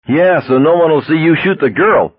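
high-pitched hysterical heckle; hysterical yell. A narrator's reading. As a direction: jeer high from the crowd. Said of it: "a hysterical voice yelled".

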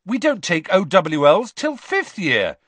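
loud indignant protest; object, offended. Acted. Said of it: "said Dean Thomas indignantly".

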